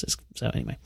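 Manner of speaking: fading to a voiceless murmur; trailing off. Real, not acted. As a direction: let it die away under the breath. Embarrassed aside about chick flick.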